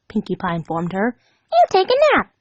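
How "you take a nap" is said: high and coy; sly high Sorta. A narrator's reading. Pinkie hiding the drugged cupcake from Dash, coy and high. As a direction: tease it, hiding the secret.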